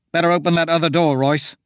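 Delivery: quick, urgent call; voiced urgent order. A narrator's reading. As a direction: hurry him along.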